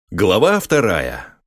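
ordinary delivery

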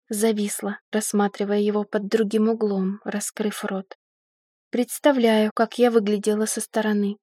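usual speaking voice

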